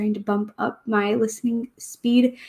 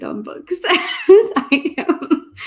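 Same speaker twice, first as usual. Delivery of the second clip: slow, laughing and louder; 7 semitones higher, 6 dB louder, much slower than usual. Real, not acted. Laughing at own book obsession.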